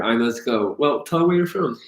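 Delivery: ordinary delivery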